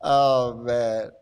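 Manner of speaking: ordinary talk, nothing marked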